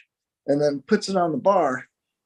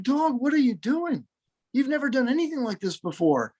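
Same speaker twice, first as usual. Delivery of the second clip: acted astonishment, pitched well up; shocked owner; 6 semitones higher, 3 dB quieter than usual. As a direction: plead with the dog in disbelief. Owner shocked at finding dog misbehaving.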